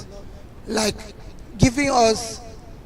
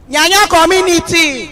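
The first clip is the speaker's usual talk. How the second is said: calling out; 5 semitones higher, 3 dB louder than usual. Real, not acted. Speaker hails her community for a response, calling out. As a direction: call out to rouse a whole crowd. Greeting the crowd, repeated call.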